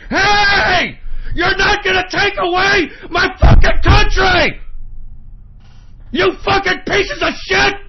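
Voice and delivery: real fury, voice cracking; raging shout. Real, not acted. Host screams at globalists and calls for patriots, raging shout. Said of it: "the reason I'm getting so pissed off".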